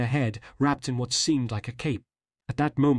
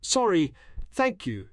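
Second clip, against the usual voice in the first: higher-pitched, courteous reply; polite girl on the phone, pitched up; 7 semitones higher, slower than usual. A narrator's reading. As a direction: say it politely for someone listening in. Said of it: "very politely as if for her mother's benefit".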